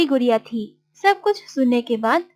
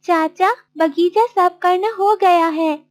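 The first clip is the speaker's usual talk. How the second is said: higher, eager-to-help character voice; obliging Martin; 6 semitones higher than usual. Acted. Martin agreeing to clean uncle's garden, obliging. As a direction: answer respectfully in Martin's higher voice.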